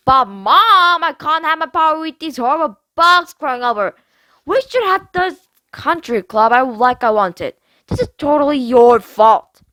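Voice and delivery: put-on mean girl griping at her mom; spoiled girl whining. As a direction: whine like a spoiled rich girl. Mackenzie voiced, whining.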